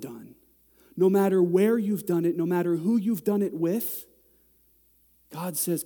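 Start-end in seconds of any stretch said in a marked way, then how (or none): none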